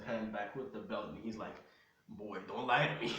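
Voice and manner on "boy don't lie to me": loud parental warning; firm, loud scolding; threatening father. Acted. Father with belt cocked warning his lying son, threatening.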